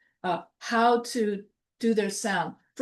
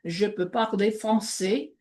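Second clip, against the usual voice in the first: speaking real French. Shown as a demonstration. Teacher showing she speaks French, in French. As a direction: show off the French, native style. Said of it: "since I speak French".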